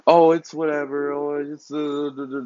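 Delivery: sarcastic dim-witted impression; mocking dumb voice. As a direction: play the police as clueless and lazy. Mocking the police's indifference.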